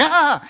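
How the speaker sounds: high, loud yelp of pretend fright; hammed-up scared "ah"; mock frightened scream. Preacher pretending Jesus is scared of the demonized, mock scream. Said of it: "Do you think Jesus gets scared?".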